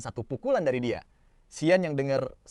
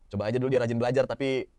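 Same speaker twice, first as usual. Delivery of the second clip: ordinary delivery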